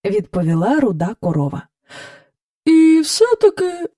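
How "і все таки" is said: slow, high, hesitant; searching for words. A narrator's reading. Cow describing her feeling to the king, searching for words. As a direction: draw it out as if choosing the next word. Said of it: "ніби добираючи слово".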